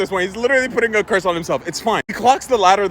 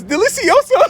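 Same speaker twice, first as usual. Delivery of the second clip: put-on high gleeful toddler cry; 13 semitones higher than usual. Toddler finishing each chocolate, high gleeful voice. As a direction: relish it like a tiny child. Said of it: "she just goes".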